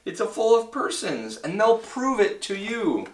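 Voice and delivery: high, pleading character voice; Horton gasping. A narrator's reading. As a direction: plead in alarm, pitched well up. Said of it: "gasped Horton".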